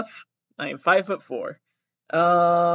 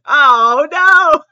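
loud, much higher "oh no!" of horror; shocked cry; 10 semitones higher, 13 dB louder than usual. Real, not acted. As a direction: yelp in mortified surprise. Shocked at own reading.

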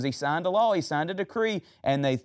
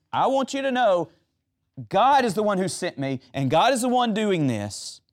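higher, louder earnest paraphrase of Jesus; Jesus explaining to the Father, raised and earnest; voicing Jesus; 6 semitones higher, 4 dB louder than usual. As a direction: speak it as Jesus, urgent and raised. Voicing Jesus's prayer intent.

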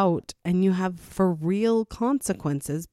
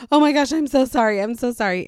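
higher, louder, fast embarrassed sorry; flustered apology; 6 semitones higher, 7 dB louder, faster than usual. Retold or imitated. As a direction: gush out a hurried apology. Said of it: "And I was like".

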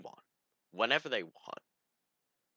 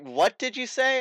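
much higher, louder outraged question; mock indignant; 14 semitones higher, 8 dB louder, slower than usual. Imitating Allie outraged at his excuse. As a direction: shriek it in disbelief. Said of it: "she was just like".

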